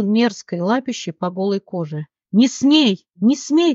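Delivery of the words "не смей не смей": higher-pitched, moderately raised cry of protest. A narrator's reading. Leona fights off the groping thug, crying out in protest. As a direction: cry it twice in urgent protest, short of screaming. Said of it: "кричала она".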